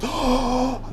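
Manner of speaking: sharp high gasp of offence; shocked gasp. Retold or imitated. People he talks to gasp in mock outrage. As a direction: gasp as if scandalised. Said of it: "they're like".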